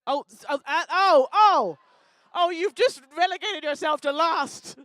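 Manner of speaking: loud, high, scandalised oh's; mock outrage. Host relegating New Zealand to last, mock outrage. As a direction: build from oh's into a loud, drawn-out verdict.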